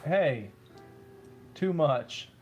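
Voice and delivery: ordinary delivery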